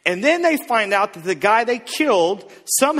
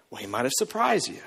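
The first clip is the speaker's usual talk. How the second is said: ordinary talk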